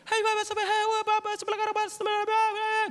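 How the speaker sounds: high calling vendor voice from afar; ghost market vendor. Retold or imitated. Ghost market trader calling customers, high distant call. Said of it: "suara transaksi dari kejauhan".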